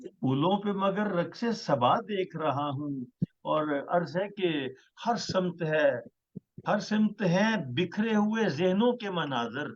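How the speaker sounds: measured, stately poetry recital; ghazal recitation. Acted. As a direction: recite each line with weight. Poet recites his ghazal.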